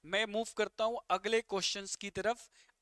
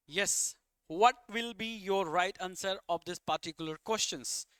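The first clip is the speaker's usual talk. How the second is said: slow question; much slower than usual. Shown as a demonstration. Teacher asks class slowly.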